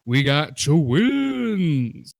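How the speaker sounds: very slow, loud announcer stretch; drawn-out MC hype. Pretend. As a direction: stretch every word like a hype MC.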